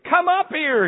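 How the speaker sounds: put-on child insisting from upstairs; child calling again. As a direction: call out again like the little boy upstairs. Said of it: "he said again".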